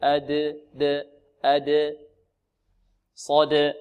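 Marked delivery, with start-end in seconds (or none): 0.7-2.0 slow أد with a bounced d; slow qalqala. Shown as a demonstration. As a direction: bounce أد slowly. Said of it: "بل أد".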